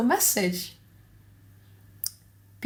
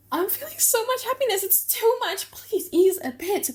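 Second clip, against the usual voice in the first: much higher, fast breathless joy; overwhelmed joy; 12 semitones higher than usual. Acted. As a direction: let the happiness spill over.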